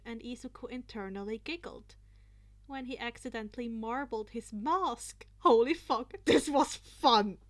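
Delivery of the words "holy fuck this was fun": thrilled exclaim. A narrator's reading. Izuku thrilled mid-dance, loud and delighted. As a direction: savour the fun out loud.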